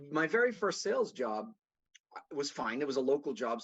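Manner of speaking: normal talking voice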